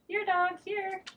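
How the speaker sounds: high coaxing call; calling a dog. Acted. Daughter calling the dog, high coaxing call. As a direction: coax the dog over.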